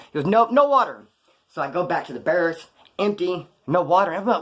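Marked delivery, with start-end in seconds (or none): 0.3-1.1 imitated cop, higher and louder; firm refusal. Cop refuses an inmate his water run, firm refusal. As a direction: shut the request down emphatically.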